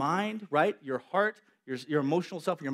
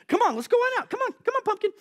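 much higher, sing-song coaxing voice; playful coaxing; 4 dB louder, faster than usual. Acted. God coaxing Elijah out onto the mountain, high and playful. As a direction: coax him like a child.

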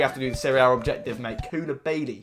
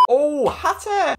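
high, slow drawn-out shout of surprise; oh, like you can't believe the card; surprised; 11 semitones higher, much slower than usual. Real, not acted. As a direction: stretch it out in shock. Reacting to a pack pull.

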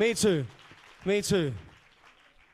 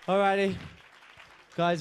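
ordinary talk, nothing marked

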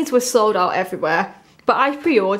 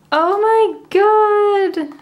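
high, very slow, swooping amazement; long, high-pitched real swoon; awestruck delight; 9 semitones higher, 3 dB louder, much slower than usual. Real, not acted. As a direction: draw it out in thrilled disbelief. Awed at seeing light stick.

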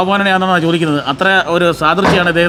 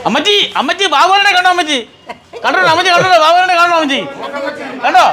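laughing call; 10 semitones higher than usual. Acted. Playfully calling out to mother.

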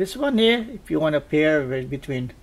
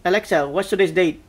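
loud voice command; 2 semitones higher, 7 dB louder than usual. Real, not acted. Speaking a command to Alexa.

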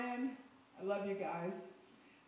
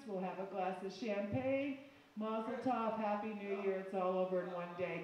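ordinary talk

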